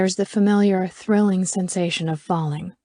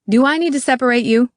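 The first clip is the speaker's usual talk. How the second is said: quick, loud scolding, pitched up; bark; 5 semitones higher, 5 dB louder, faster than usual. A narrator's reading. McCarthy scolds the three in the back, barking. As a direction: rap it out as a threat. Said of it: "McCarthy barks".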